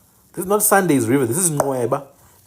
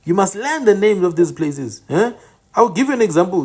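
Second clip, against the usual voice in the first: ordinary talk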